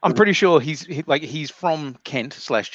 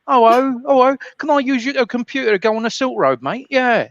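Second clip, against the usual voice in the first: high, excitable South London geezer; pitched-up South London wheedle; Kent cousin accent; 10 semitones higher, 4 dB louder than usual. Acted. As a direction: play the dodgy cousin from Kent. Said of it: "my cousin is from, uh, Kent".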